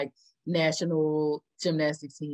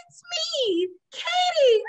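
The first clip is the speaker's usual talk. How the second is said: high nurse voice; 3 dB louder, slower than usual. Retold or imitated. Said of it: "She said".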